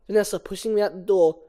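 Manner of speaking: ordinary talk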